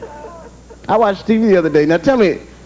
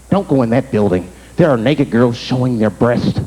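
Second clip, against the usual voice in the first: lowered mock-serious caution; warning stranger; 8 semitones lower than usual. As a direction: hush it like a concerned passerby. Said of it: "it's like".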